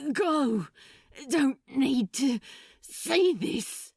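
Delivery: strained, through clenched teeth; gritted teeth. Acted. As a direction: push the words out in pain. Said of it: "he panted, through clenched teeth".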